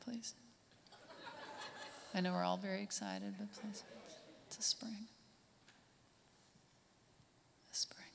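extremely soft, whispery request for quiet; hushed plea. Acted. Comic quiets excited audience before impression, whispery hush. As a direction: shush them almost under the breath.

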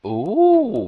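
high delighted ooh; excited squeal. Real, not acted. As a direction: squeal with glee. Spots more chests, squeals.

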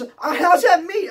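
high, loud, slow incredulous question; freaking out. Retold or imitated. Inmate challenges guard about the tray, freaking out. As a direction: stretch out the baffled protest. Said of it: "I'm freaking out".

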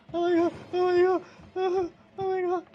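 high panicked screaming; shrieking in real terror; shaky stammering panic. Real, not acted. Attacked by monsters, screaming.

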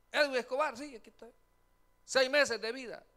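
loud, high announcement of bad news; mock doctor's verdict. Doctor shouting a patient's diagnosis, loud high verdict. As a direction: call out the verdict loudly.

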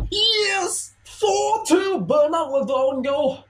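high screaming cheer; goal celebration shout. Real, not acted. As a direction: yell with delight.

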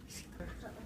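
soft whispered question; hushed hunter. Retold or imitated. Storyteller answers his hunting partner, whispering.

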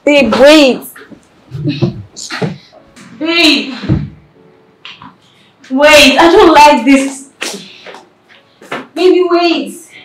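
urgent calling out. Acted. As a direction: call after someone who is leaving. Wife calls after departing husband.

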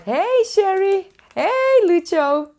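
high, slow, sing-song hellos; excited greeting. Real, not acted. Greeting viewers Sherry and Lucho, high and excited.

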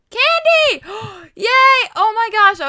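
very high, loud delighted cry; excited squeal. Real, not acted. Gamer hears the build theme is candy, squealing loudly. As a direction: shriek with joy at the theme.

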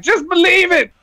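lightly hammed Naruto-style pep; mock anime hero. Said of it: "Be like".

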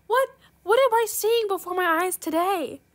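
high and loud in amazement; amazed. Real, not acted. Gamer staring at a rare offer, amazed. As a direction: gasp it out in disbelief. Amazed at what she sees.

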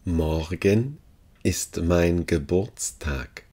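ordinary delivery

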